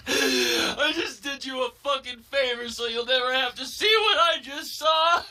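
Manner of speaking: high, tearful hammed-up yelling; crying yell. Boy explaining his violent favor to classmates, crying while yelling. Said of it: "While crying, while yelling it".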